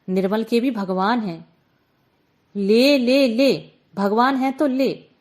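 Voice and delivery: loud furious taunting, slowed on हाँ and ले. Acted. Aunt raging at Gulki before flooding her shop, furious. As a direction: spit the words at her in rage. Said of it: "बुआ मारे गुस्से के खड़ी बोली बोलने लगी थी".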